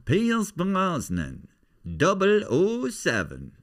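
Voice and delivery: high, slow Irish comic voice; Tommy Nicholson. Acted. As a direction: announce the name grandly, squeaky and drawn out. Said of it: "That's Tommy Nicholson, who's an Irish comedian".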